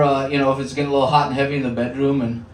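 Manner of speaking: normal talking voice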